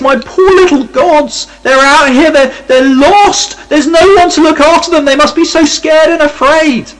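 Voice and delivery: mock tearful. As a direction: wail like a man mourning lost toys, hammed up. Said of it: "he's almost in tears as he searches".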